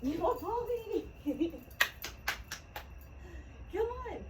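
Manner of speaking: high, sing-song coaxing of a pet rooster; high cooing call to coax the rooster over; pet talk. Real, not acted. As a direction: coo at the bird in a high voice. Woman coaxing her pet rooster.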